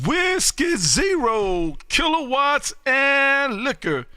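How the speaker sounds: drawn-out radio call. Real, not acted. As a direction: draw every word out like a radio hail. Calling back a station's callsign.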